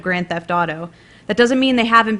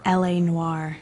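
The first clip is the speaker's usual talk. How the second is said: low breathy whisper of a femme fatale; 3 semitones lower, 4 dB quieter, slower than usual. Pretend. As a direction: breathe it low, like a quiet prayer. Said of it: "Her answer was low and breathy".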